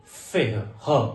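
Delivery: फेह ending in a breathy ह for the R; French R as ह. Shown as a demonstration. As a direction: then ह alone, a throaty breath. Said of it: "इसका sound रहेगा फेह". (फेह और ह, आर की जगह हल्का ह)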